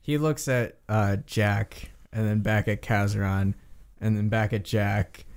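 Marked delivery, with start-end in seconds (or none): none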